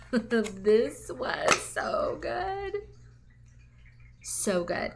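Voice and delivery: drawn-out, dreamy praise; savoring delight. Real, not acted. Reviewer swooning to viewers over a favorite melt, slow and dreamy. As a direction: stretch it out like you can still smell it.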